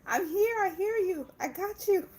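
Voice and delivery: put-on high whiny toddler wail of mommy; mock crying child. Mom mimicking her kid's crying tantrum at her. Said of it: "throw yourself on the floor crying".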